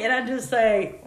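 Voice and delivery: ordinary delivery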